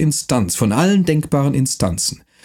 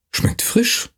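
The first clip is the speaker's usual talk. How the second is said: astonished; 12 semitones higher than usual. A narrator's reading. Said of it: "sagte er verwundert".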